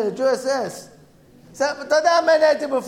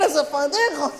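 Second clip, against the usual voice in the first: ordinary delivery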